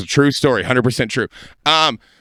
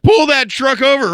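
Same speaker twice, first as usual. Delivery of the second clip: higher, louder shouted command; yelled order; 10 semitones higher, 3 dB louder than usual. Retold or imitated. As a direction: bark it at the driver. Acted urging friend to stop truck.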